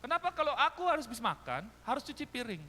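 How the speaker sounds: high, loud complaint; imitated child's protest. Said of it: "saya pernah protes". (menirukan anak protes dengan nada tinggi)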